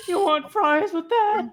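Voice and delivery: put-on high, slow, loud fast-food teen; scratchy-voiced teen. Host playing a teen working the fryers, scratchy-voiced. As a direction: squeak it like a teenage fry cook. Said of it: "scratchy-voiced, uh, teen working the fryers".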